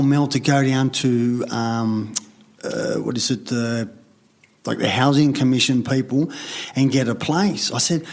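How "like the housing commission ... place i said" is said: normal talking voice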